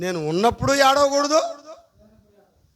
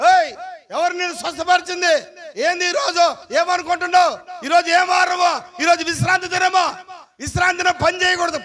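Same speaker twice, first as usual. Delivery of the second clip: higher, accusing challenge to the healed man; mock scolding; 6 semitones higher, 4 dB louder than usual. Objectors confronting the healed man, mock scolding. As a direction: bark the Sabbath rule at him.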